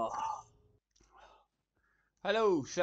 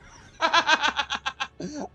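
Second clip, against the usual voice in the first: higher, rising giggle fit; high giggling laugh; 11 semitones higher, 7 dB louder than usual. Real, not acted. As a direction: giggle helplessly. Laughing after repeat trick.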